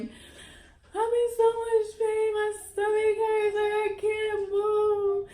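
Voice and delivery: high, slow, sobbing whine; imitating herself crying on the phone. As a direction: wail it out like you're crying in pain. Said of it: "And I'm like".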